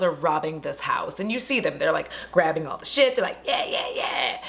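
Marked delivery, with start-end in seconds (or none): 3.4-4.4 low, slow crook's gloating; drawn-out villainous yeahs, low and laughing; ne'er-do-well. Retold or imitated. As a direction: imitate robbers gleefully looting. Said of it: "ne'er-do-well style".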